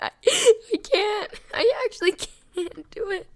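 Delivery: high-pitched cracking-up laughter; helpless laughter. Real, not acted. YouTuber losing it at a bad film, helpless laughter. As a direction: squeak the words out through laughter.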